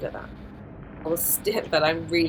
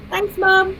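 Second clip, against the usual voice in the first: high squeaky thanks to mum; embarrassed kid voice; 13 semitones higher, 3 dB louder than usual. Pretend. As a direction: play the mortified kid.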